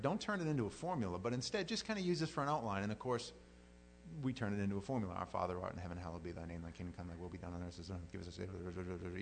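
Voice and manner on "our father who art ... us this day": low, soft rattled-off prayer; mindless recitation. Shown as a demonstration. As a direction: race through it on autopilot, barely articulating. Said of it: "say it without even thinking about it".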